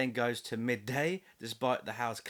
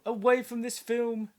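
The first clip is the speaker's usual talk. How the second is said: high mystical voice; 10 semitones higher, 4 dB louder than usual. Shown as a demonstration. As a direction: chant it high and eerie. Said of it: "Ooh, let me try".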